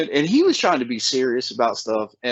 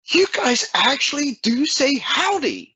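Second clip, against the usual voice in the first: higher-pitched, slow imitation of a girlfriend; astonished girlfriend; 13 semitones higher than usual. Visiting girlfriend teasing the locals about howdy, mock amazement. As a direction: lightly mimic her, pitched up and drawn out. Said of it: "and she goes".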